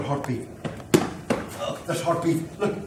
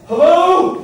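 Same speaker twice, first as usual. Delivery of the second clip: high, loud hail; calling out; 11 semitones higher, 9 dB louder than usual. Acted. Fireman hailing a survivor who has wandered, calling out. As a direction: shout to get their attention. Fireman hails someone out of place.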